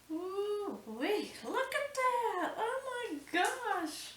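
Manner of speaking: very high, slow squeals of wonder; breathless high-pitched delight; squealing delight. Real, not acted. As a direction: gush over the meringue. Thrilled at her meringue.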